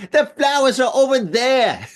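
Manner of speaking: high-pitched, hammed-up Australian; horrible Australian accent. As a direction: do a badly faked Australian shout. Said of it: "That's my horrible, horrible Australian accent".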